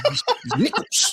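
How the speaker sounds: NICKELS punched high, through laughter; emphatic coach imitation. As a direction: lean hard on the first syllable of "nickels". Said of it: "with so much emphasis on the".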